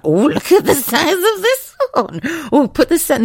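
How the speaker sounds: high, loud, slow cackling delight; gleeful gawking ladies. Retold or imitated. Cheeky older ladies gawking, high and delighted.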